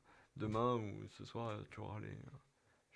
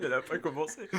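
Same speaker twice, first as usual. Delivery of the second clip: laughing; 5 semitones higher, 5 dB louder than usual. Real, not acted. Laughing at joke.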